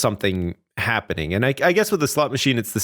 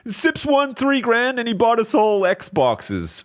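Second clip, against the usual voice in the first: excited retelling; 13 semitones higher than usual. Pretend. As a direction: gush about the win to friends.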